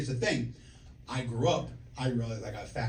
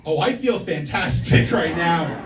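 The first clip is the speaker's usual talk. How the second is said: loud, drawn-out mock delight; overjoyed exclamation; 3 semitones higher, 11 dB louder, slower than usual. Patient to doctor during the exam, hammed-up overjoyed exclamation. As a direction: belt it out slowly with exaggerated pleasure.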